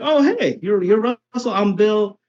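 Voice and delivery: light up as if meeting a long-lost pal; delighted greeting. Retold or imitated. Bill introducing himself warmly.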